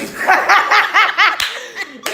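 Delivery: high, loud cackling laugh that takes over; loud burst of laughter. Real, not acted. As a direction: break out laughing hard mid-story. Teller cracks up mid-story.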